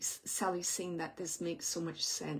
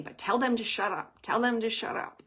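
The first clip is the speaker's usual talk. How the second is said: insistent retold demand; 3 semitones higher, 7 dB louder than usual. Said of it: "He's just saying it like that".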